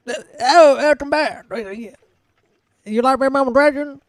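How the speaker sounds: put-on loud, high-pitched old man voice. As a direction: greet customers as a loud old man behind a counter. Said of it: "you see that old man sitting".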